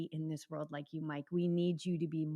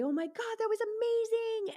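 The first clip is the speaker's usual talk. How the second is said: much higher, breathless delight at the finish; gushing finish-line joy. Pretend. Athlete crossing the finish line, gushing with high delight. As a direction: squeal it like you just crossed the line. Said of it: "you're gonna say".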